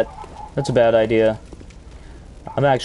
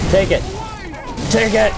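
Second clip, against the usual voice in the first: gleeful taunt; 10 semitones higher than usual. Real, not acted. Hitting enemies in combat.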